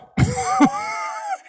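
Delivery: high mocking laugh. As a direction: cackle like the kids teasing. Mock laugh after taunt.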